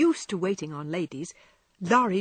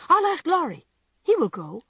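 eager; 10 semitones higher, 7 dB louder than usual. A narrator's reading. Jo eager to fetch Laurie.

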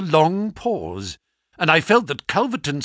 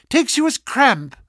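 ordinary delivery

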